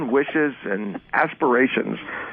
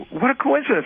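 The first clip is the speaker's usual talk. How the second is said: high-pitched, put-on wonder; mock amazement; 13 semitones higher than usual. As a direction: exclaim it high, like a naive onlooker. Acted naive reaction to the change.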